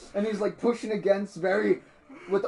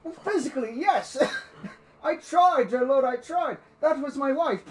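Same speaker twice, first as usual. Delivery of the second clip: high, pleading confession; anguished old villager; 8 semitones higher, 4 dB louder than usual. Acted. Villager accused of weakness over his family, anguished protest. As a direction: protest in despair that you did your best. Villager admits he was too weak.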